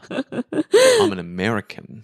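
very slow, drawn-out declaration; deliberate stock answer. Acted. Prisoner repeating her cover story to interrogators, slow and deliberate. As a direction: stretch out the stock reply.

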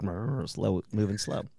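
low, soft, groggy mumble; hammed-up sluggish grunt, sinking low; mock uncaffeinated sluggishness. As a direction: play yourself slowed down without coffee. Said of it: "If I didn't have my cup of coffee".

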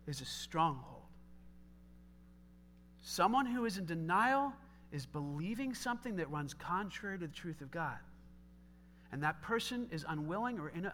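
normal talking voice

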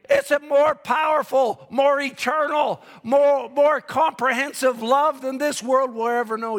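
fervent sermon shout; preaching at full force. Acted. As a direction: build it to a shouting climax.

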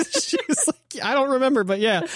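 high, giggling words; real laughter spilling into the line; laughing. Real, not acted. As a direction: talk through a laugh. Laughing through a retold quote.

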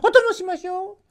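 a pheasant calling to Momotaro; high birdlike voice. A narrator's reading. Pheasant joins.

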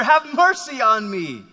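acted shout for mercy; crying out. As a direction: keep calling out over the crowd. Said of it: "he cried out even louder".

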